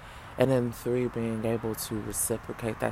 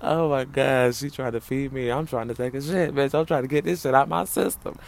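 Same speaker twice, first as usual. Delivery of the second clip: loud joking rant; 3 semitones higher, 6 dB louder than usual. Real, not acted. Joking about needing the toilet.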